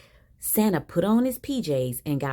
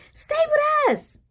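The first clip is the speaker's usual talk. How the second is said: put-on very high, drawn-out kids' cheer; kids shouting; 4 dB louder, slower than usual. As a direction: shout it like excited children, slow and high. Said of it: "the kids shouted".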